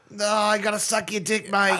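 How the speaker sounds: hammed-up Australian bloke; mock Australian. Mocking an Everest climber as an Australian bloke, hammed-up accent. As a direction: ham up an Aussie bloke, mate and all.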